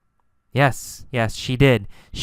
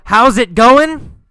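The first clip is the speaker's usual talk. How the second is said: raised, high calling voice; yelled; 8 semitones higher, 5 dB louder than usual. Acted. Teacher asking whom Carlos called to, yelled. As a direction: yell it out as a quoted greeting. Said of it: "Did he yell".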